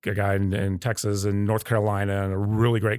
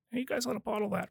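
quoting customers; 12 semitones higher, 10 dB quieter, faster than usual. Retold or imitated. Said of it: "We had a lot of people telling us".

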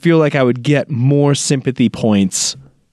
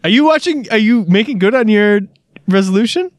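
eager, bright and higher; excited to hear about his progress; 9 semitones higher than usual. Real, not acted. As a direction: pounce on the question with glee. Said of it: "I'm very excited about this".

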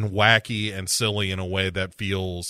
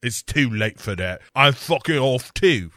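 mock cockney quitting. Spice Girl quits.

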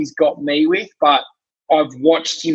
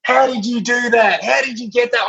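higher, caught out and amazed by the trick; stunned disbelief; 8 semitones higher than usual. Retold or imitated. Retelling being fooled by a mate's magic trick, higher stunned disbelief. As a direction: replay the moment the card turned up under the plate.

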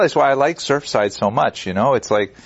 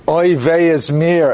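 slow, higher Yiddish grandmother sigh; drawn-out Yiddish accent lament; 5 semitones higher, 4 dB louder, slower than usual. Acted. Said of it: "And then the third one says".

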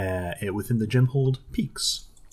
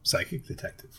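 soft unvoiced prompt; whispering; 10 dB quieter, faster than usual. Real, not acted. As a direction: whisper the correction to her. Prompting the correct title.

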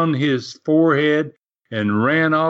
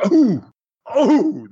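crowd gasp; 8 semitones higher, 4 dB louder than usual. Retold or imitated. Crowd reacting to every chop.

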